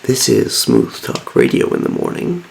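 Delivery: a sleepy, husky radio host winding down; tired radio host. Acted. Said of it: "I love my voice when I get tired".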